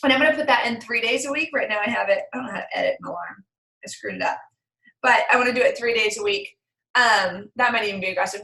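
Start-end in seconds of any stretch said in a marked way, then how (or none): 2.3-4.4 whispered aside. Real, not acted. Muttered aside about the alarm.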